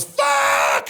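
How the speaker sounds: acted-out livid brother's yell; long, loud bellow of rage. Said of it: "He's, like, livid".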